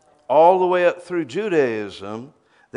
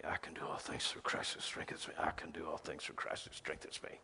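whispered repetition, voiceless and soft; whispered verse; 9 semitones lower, 20 dB quieter than usual. Retold or imitated. Believer repeating the verse in a whisper. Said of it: "You say".

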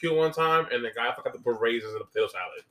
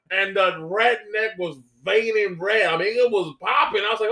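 ordinary delivery

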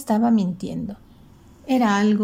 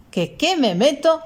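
higher, indignant echoed question; furious; 7 semitones higher, 3 dB louder than usual. A narrator's reading. Grandfather before shoving the driver, furious. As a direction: repeat it angrily, pitch jumping up. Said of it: "dijo el abuelo furioso".

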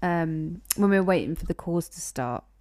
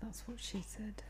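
barely audible whispered quip; 23 dB quieter than usual. Real, not acted. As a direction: slip the joke in under your breath. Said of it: "'cause you whisper".

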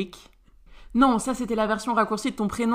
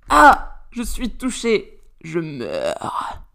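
mock wounded death; 5 dB louder, much slower than usual. Mock dying from a jab.

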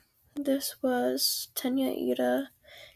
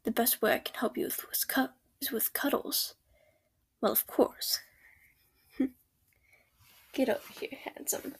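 ASMR whisper. Acted. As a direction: whisper gently, close to the ear. Whispered comforting boyfriend roleplay.

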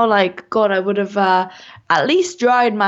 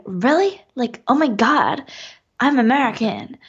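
imitated American accent; 3 semitones higher than usual. British guest mimicking American host's voice, American accent. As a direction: play up an excitable American accent. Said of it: "because you're so, like".

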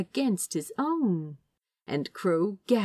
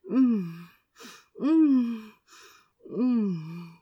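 soft little breathy whimpers of pleasure; three soft mm whimpers; much slower than usual. A narrator's reading. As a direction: let out small pleasured mm sounds. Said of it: "whimpers of pleasure".

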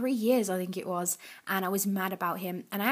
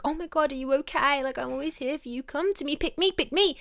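higher and louder, hammed-up desperate friend; mock needy gushing; 5 semitones higher, 3 dB louder than usual. Girl mocking a needy friend, hammed-up and pleading. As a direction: overdo the eager pleading, climbing as it goes. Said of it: "That's the energy".

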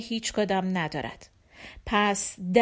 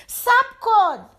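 high, loud, drawn-out call; children's shout; 11 semitones higher, 7 dB louder, slower than usual. Acted. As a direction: yell after someone like a child. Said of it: "داد زدند". (داد کشیدهٔ بچگانه)

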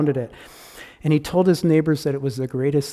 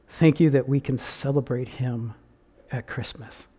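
prayer. Real, not acted. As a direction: pray aloud gently for the listeners. Preacher leads closing prayer.

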